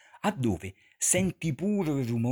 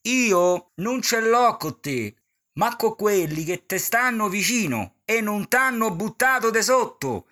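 Petrolini quote; 9 semitones higher, 5 dB louder than usual. Retold or imitated. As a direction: deliver the comic's put-down with flourish.

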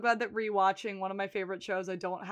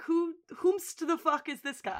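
mock bafflement; 7 semitones higher than usual. Mock-baffled reaction to unexplained new character.

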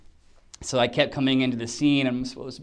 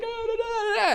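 mock crying gibberish. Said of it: "I started to cry".

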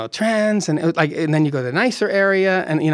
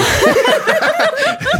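much higher, louder burst of laughter; big laugh; 5 dB louder than usual. Real, not acted. Storyteller cracking up at the Robin Williams line, loud laugh.

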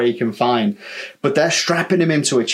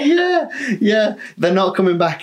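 laughing; 7 semitones higher than usual. Real, not acted. Still laughing after punchline.

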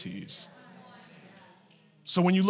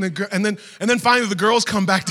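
ordinary talk

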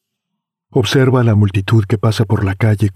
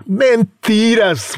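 scoffing; 12 semitones higher, much slower than usual. Acted. Scoffing friend.

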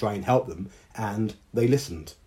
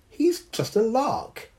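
laughing scorn; 13 semitones higher, 4 dB louder than usual. A narrator's reading. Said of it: "Dao laughed".

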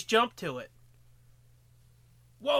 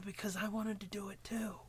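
whispering; 9 dB quieter than usual. Acted. Mock quarrel in whispers.

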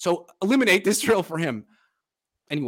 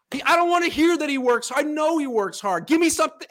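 raised pitch, frustrated venting; exasperated outburst; 11 semitones higher, 3 dB louder than usual. Real, not acted. Host venting to his son about the evasive coach, exasperated. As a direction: give me something, fed up.

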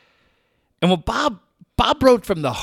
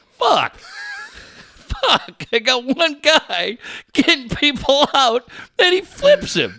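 retold exasperation; 9 semitones higher than usual. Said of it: "Boch looks at me, he's just like".

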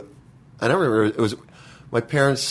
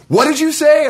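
much higher and louder mock shout; retold furious yell; 7 dB louder than usual. Brother reopening the slammed door, yelling the demand. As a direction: yell it after yanking the door open. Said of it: "then opens it up and goes".

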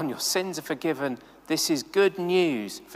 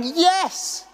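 eager yes; 12 semitones higher, 3 dB louder than usual. Pretend. Imagines Isaiah volunteering eagerly.

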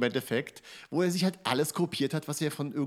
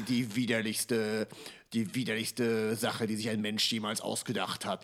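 horror trailer voice; 4 semitones lower, 4 dB quieter than usual. Retold or imitated.

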